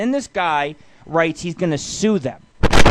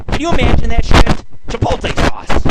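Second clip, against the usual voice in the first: loud, higher, incredulous cry; disbelieving outburst; 6 semitones higher, 9 dB louder than usual. Real, not acted. Radio host reacting to the ad for listeners, loud disbelief. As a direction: blurt it out in shock.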